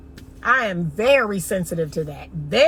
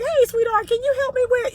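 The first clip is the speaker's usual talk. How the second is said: high-pitched mock pet-name greeting; imitated Southern sweet-talker; 13 semitones higher than usual. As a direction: imitate a man casually sweet-talking a stranger, pitched way up. Voicing Southern men in stores.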